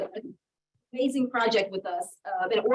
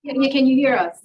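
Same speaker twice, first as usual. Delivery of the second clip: loud, higher call over a bad line; 4 semitones higher, 9 dB louder than usual. Real, not acted. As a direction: call out to be heard far off. Calling to guest on bad line.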